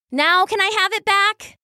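high-pitched, pressing question; impatient little weasel. A narrator's reading. Sister asking brother for her tapestry, impatient. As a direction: ask high, eager to get it back.

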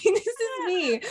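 put-on high, childish baby voice; lightly put-on little-girl voice. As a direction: talk like a small child in protest. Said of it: "it's rude".

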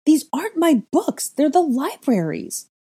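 high, loud, indignant shouting; furious protest at full voice; outraged roar. A narrator's reading. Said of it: "I roared".

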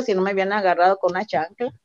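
usual speaking voice

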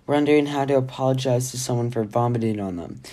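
ordinary delivery, nothing marked

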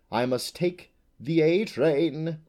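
a hint of a prim, slow butterfly voice; polite. Butterfly politely takes leave of the unicorn, slow and courteous. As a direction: say it politely, unhurried. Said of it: "he said politely".

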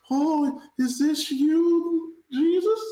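high, drawn-out awed gasp at a miracle; awed believer. Retold or imitated. Friend mocking a spooked victim, high and awestruck. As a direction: play the stunned believer meeting Jesus.

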